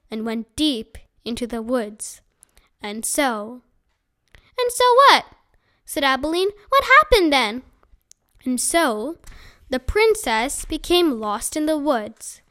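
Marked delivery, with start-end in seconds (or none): 4.6-5.3 high, loud demanding question; impatient interruption. Acted. Abilene interrupting the storyteller, impatient and demanding. 6.7-7.6 higher, louder pressing question; eager demand. Acted. Abilene urging the storyteller on, eagerly insistent.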